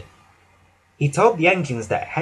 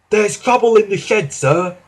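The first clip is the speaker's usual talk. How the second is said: higher, louder worried station master; 7 semitones higher, 3 dB louder than usual. Acted. Station master warning the Fat Controller about the shed, raised voice. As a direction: report trouble to the boss, a bit anxious. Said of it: "he said".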